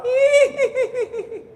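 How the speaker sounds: high, drawn-out eerie cackle; ghostly giggle. Retold or imitated. Preacher mimics the voice at the graveyard, ghostly giggle. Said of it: "ada suara".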